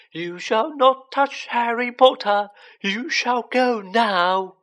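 high, loud, very slow elf command; fierce high order, each word stretched. A narrator's reading. Dobby pointing down at Malfoy, fiercely.

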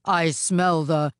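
slow ogre voice; drawn-out ogre sniffing out prey; wicked Oni, insistent. Acted.

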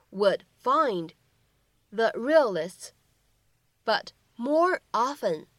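slow, deliberate reading of the English line; slow model reading. Shown as a demonstration. Teacher reading a line to students, slow and deliberate. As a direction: read each phrase slowly for learners.

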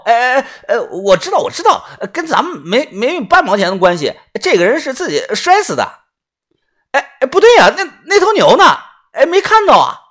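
high, stammering yes-man; flustered sidekick. Acted. Scolded sidekick agreeing then missing the cow, high and flustered. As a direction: scramble to agree, pitch climbing into alarm.

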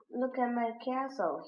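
ordinary delivery, nothing marked

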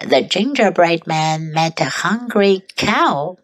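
plain reading voice

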